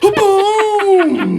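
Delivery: high, loud boom; triumphant shout. Real, not acted. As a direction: celebrate the win out loud. Triumphant exclamation.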